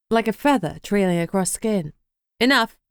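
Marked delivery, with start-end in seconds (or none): 2.4-2.8 abrupt. Acted. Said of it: "Amber said abruptly".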